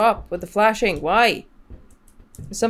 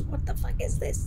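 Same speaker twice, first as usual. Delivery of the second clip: breathless shocked whisper; whispered what the fuck under the breath; whispered swear; 7 dB quieter than usual. Real, not acted. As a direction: hush it out in disbelief. Alarmed whisper at spooky sight.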